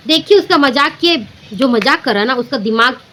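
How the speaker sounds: ordinary talk, nothing marked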